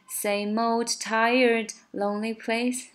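put-on "old" and "tired" sung without their final D, slowly; swallowed D. Shown as a demonstration. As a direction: glide past the D sounds. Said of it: "old和tired结尾的D都被吞掉".

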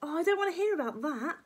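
put-on dismayed little-boy voice; crying out. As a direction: cry out as a revolted child. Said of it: "cried George".

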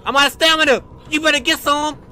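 ordinary talk, nothing marked

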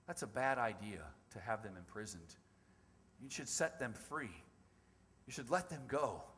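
low, soft, cautious advice, retold; not sure. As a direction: venture it carefully to someone powerful. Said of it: "he's not sure about it".

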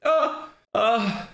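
high, alarmed "oh God, oh no"; real panic at the heat; panicked. Real, not acted. Reacting to the chili heat.

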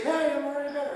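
eager. Retold or imitated. Patient quoted eagerly.

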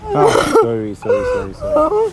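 high, tearful sorry; crying apology. Acted. Apologising to the upset girlfriend, in tears. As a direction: sob the sorry out to her.

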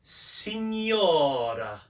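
"signora" very slow, with a long O; drawn-out Italian pronunciation. Shown as a demonstration. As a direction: stretch the O in "signora", falling at the end. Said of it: "And the second word".

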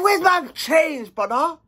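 high, drawn-out confrontational demand; acted angry demand. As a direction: play the creditor demanding his money. Acted caller demanding his money.

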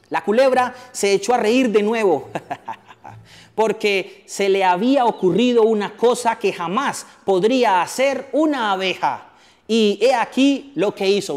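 plain reading voice

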